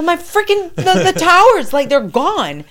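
telling the ex the towers fell, screaming. Retold or imitated. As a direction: yell it in total panic.

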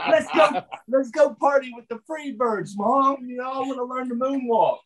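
put-on high, laughing kid pleading; excited kid. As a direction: beg mom like a thrilled little boy. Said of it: "being an eight-year-old kid".

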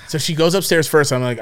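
usual speaking voice

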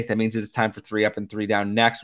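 ordinary talk, nothing marked

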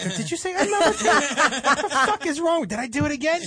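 rising outraged disbelief, half laughing; retold exasperation. Telling off brother for ordering that way, exasperated.

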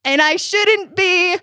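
exasperated yell, acted out for laughs; mock yelling. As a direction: act out a parent yelling mid-lecture. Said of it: "I'm yelling while I'm yelling".

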